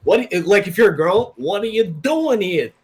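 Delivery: high, slow hammed-up tough guy; sleazy wiseguy come-on, laughing; greaser tough guy. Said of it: "He's like".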